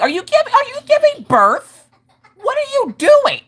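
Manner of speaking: very high, loud mock alarm; exasperated disbelief. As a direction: squeal the questions in comic bewilderment. Exasperated mother at kid's noisy breathing.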